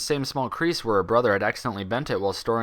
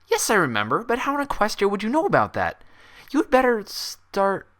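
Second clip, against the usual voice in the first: higher and louder, demanding; alarmed Twilight; 11 semitones higher, 4 dB louder than usual. Acted. Twilight demanding how the doctor knows, higher and louder.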